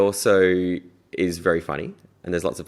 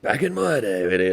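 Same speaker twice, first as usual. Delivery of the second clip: lightly voiced old-timer, slowed; a hint of a grumpy veteran instructor; old instructor impression; slower than usual. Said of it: "put on their voices".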